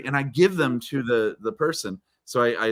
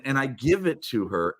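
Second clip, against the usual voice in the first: usual speaking voice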